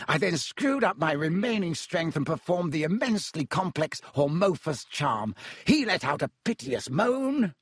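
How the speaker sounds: high, theatrical boastful showman; Lockhart voice. Acted. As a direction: ham up the heroic story.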